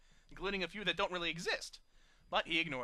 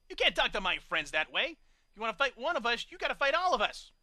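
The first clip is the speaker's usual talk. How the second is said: loud, rising indignation; defiant; 5 semitones higher, 7 dB louder than usual. A narrator's reading. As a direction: stand up for your friends. Wondercloud defends her friends.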